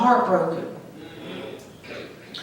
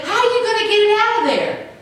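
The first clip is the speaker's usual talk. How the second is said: much higher, doubtful woman's voice; mimicking the woman; 7 semitones higher than usual. Preacher quoting the woman's doubt again, high-pitched. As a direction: play her disbelief, high and quick. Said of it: "even though she said".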